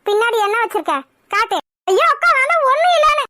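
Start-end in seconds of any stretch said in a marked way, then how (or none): 1.9-3.2 high, pleading protest; panicked denial. Acted. Girl hiding something from elder sister, panicked denial. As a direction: fend her off with a high-pitched wail of denial.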